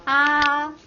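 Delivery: AH sung out long on one high, level pitch. Shown as a demonstration. Teacher models first tone for the class, high and level. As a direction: hold AH high and perfectly even. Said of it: "High means high in pitch".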